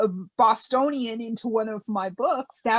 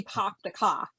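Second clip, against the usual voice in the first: R-less New England "park the car"; New England accent; 2 semitones lower, 4 dB quieter than usual. Shown as a demonstration. Denying she talks this way, mock New England accent. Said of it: "I do not say".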